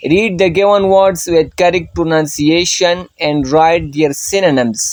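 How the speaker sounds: slow, deliberate reading of the instructions; careful classroom read-aloud; slow textbook reading. Shown as a demonstration. Reads exercise instruction aloud.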